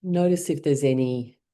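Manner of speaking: settled meditation-guide delivery; guided mindfulness. Shown as a demonstration. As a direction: ease them into stillness, leaving space between phrases. Leads mindfulness check-in.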